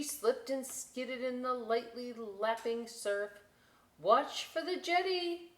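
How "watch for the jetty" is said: high, louder fretful warning; fretting. Acted. Lighthouse warning the boat, high and fretful. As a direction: worry it out loud. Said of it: "Lighthouse fretted".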